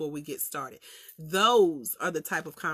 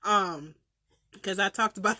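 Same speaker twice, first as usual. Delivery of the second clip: normal talking voice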